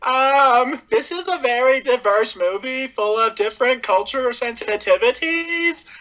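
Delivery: put-on high Valley Girl accent. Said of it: "with, like, Valley Girl voices".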